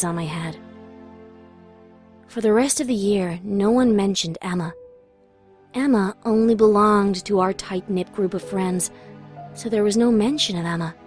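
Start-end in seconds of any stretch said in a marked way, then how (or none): none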